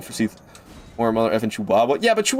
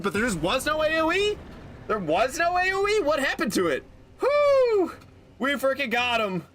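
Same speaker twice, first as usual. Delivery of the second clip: elated; 13 semitones higher, 5 dB quieter than usual. Real, not acted. Boss finally beaten.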